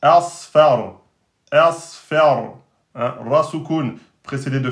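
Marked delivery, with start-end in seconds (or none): 0.0-2.6 « asfar » fort, s et r emphatiques; prononciation emphatique. Shown as a demonstration. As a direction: appuyez « asfar », plus fort, bien emphatique. (« asfar » lu fort et emphatique)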